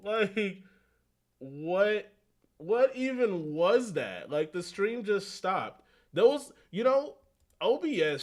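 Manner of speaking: like you just found out the stream died; exasperated disbelief. Real, not acted. Streamer finds stream cut off.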